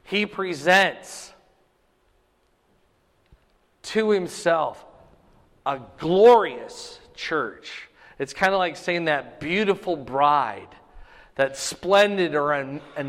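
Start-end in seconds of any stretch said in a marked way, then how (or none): 0.0-7.9 "glorious" stretched and louder, every word slowed; slow emphatic reading. Shown as a demonstration. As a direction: read it out slowly with a long pause before "to himself", hitting "glorious". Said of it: "it highlights the idea of glorious".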